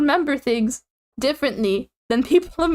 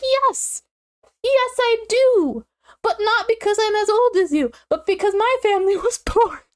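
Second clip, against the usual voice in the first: defensive retort; 9 semitones higher than usual. Retold or imitated. Answering her husband's teasing with a high, indignant retort. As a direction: snap back at the husband's teasing. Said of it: "And I'm like".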